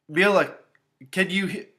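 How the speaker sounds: a touch of alarm from a father; urgent calling. A narrator's reading. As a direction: call through a breaking phone line. Father calls over failing line.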